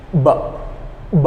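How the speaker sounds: "b" as a crisp lip release after a hum; teacher demonstrating "b"; b sound demo. Shown as a demonstration. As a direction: pop the lips apart on "b". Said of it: "So we do like".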